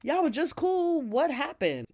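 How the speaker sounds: high, loud mock disbelief; imitating a startled friend; shocked. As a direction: retell her shock, pitch jumping up. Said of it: "Like, shocked".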